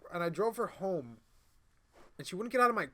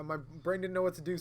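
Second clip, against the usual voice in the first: ordinary delivery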